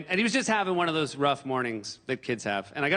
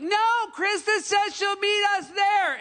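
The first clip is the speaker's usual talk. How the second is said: exasperated repeat yell; slower than usual. Retold or imitated. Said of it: "the first person has to be like".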